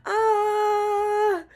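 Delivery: sudden high-pitched squeal of joy; excited squeal. Real, not acted. Streamer squeals to her chat, thrilled to be back, high excited squeal.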